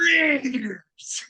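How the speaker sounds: high, drawn-out growing call sliding down; Al Davis impression. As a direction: imitate his odd one-word greeting, stretched out high. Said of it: "this is what he did".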